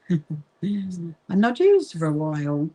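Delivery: ordinary talk, nothing marked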